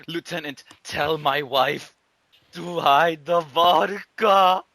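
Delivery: hammed-up slow last request; mock dying soldier. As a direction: press the order on the lieutenant. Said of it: "He's like".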